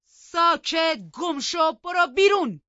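high, very loud commands; furious shout. A narrator's reading. Heathcliff orders Catherine out of the room, furious shout. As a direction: roar each order with full force. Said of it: "هیتکلیف داد زد".

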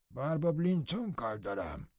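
a dour servant stating his business; Joseph the dour servant. A narrator's reading.

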